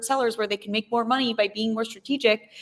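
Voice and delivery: ordinary talk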